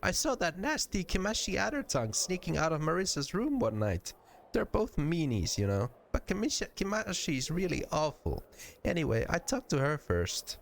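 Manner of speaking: put-on high-pitched girlish gossip; high voice, mildly indignant and chatty; tattling Nenny. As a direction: innocent little character sharing a grievance.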